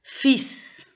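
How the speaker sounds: Fils as feess, L dropped, S hissed; silent L. Shown as a demonstration. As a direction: say Fils high and crisp, without any L. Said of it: "on ne prononce pas". (« Fils » prononcé fisse, sans le L)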